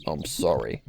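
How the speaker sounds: low sinister apology from behind; an unseen attacker apologizing quietly; mysterious voice. Acted. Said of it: "a voice said".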